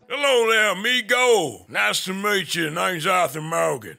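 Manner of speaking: lightly voiced Western cowpoke; cowboy voice.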